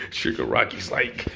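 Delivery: usual speaking voice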